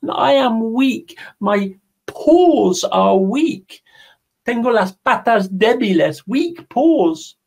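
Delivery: high, slow, pitiful rabbit voice; pleading rabbit. Acted. Rabbit begging the jaguar to hold his house, high and pitiful. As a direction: sound small and helpless.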